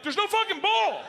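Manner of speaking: high-pitched incredulous outburst; exasperated yell. Retold or imitated. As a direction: cry it out over the cheering. Shouting over cheering crowd.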